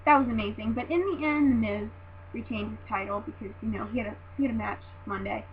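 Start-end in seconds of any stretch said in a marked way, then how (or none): none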